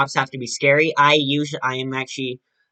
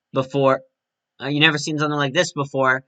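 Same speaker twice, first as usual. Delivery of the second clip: usual speaking voice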